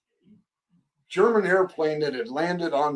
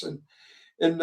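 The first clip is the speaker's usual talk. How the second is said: ordinary delivery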